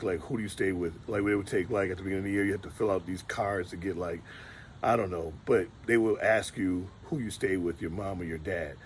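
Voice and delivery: ordinary talk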